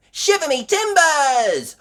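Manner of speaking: high, loud amazed exclamation; astonished gasp. A narrator's reading. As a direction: burst out in shock, pitch way up. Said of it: "gasped Miss Rabbit".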